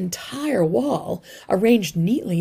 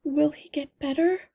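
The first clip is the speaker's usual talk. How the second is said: fearful mother; 8 semitones higher than usual. A narrator's reading.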